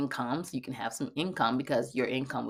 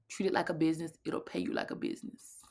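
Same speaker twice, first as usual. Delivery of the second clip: whisper; 8 dB quieter, faster than usual. Retold or imitated. Relaying what she heard while meditating, whispered. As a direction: breathe it like a message from above.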